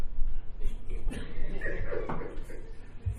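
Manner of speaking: a high, squealing burst of laughter; the pastor laughing at his wife's reaction to the hampers, a high squealing laugh. Real, not acted.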